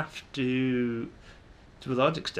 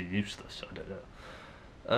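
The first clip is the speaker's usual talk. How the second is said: ordinary talk, nothing marked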